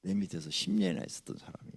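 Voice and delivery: usual speaking voice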